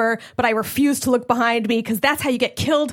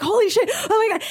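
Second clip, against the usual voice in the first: panicked relief; 9 semitones higher, faster than usual. Retold or imitated. Fleeing in the car.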